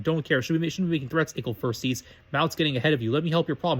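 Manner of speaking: plain reading voice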